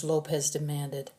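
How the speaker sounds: ordinary delivery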